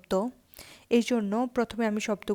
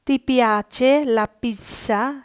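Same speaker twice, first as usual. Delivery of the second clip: slow teaching read; 3 semitones higher, 4 dB louder, much slower than usual. Shown as a demonstration. Italian teacher reading the question slowly to Bengali students. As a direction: spell out Ti piace il caffè? word by word for learners. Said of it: "পরের প্রশ্ন".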